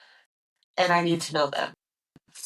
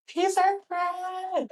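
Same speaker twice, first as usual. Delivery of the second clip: high slow; 14 semitones higher, much slower than usual. Shown as a demonstration. Repeats line in high slow voice.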